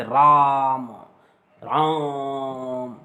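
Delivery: drawn-out call, राम stretched very long on the vowel. Shown as a demonstration. Teacher demonstrates calling out राम with a prolonged vowel. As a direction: hold the आ in राम like calling someone from afar. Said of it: "किसी को पुकारने में".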